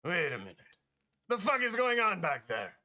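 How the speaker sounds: startled merchant in a put-on accent; Marcus. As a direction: call out at a noise in the back, high-pitched. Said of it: "and he goes".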